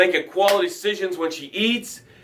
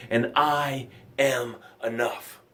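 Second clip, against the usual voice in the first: "I am enough" said slowly and softer, each word settled; calm affirmation; 5 semitones lower, 7 dB quieter, slower than usual. Shown as a demonstration. Host showing the tribe the affirmation, slow and soft. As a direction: let the words "I am enough" land slow and quiet. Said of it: "That's how it sounds".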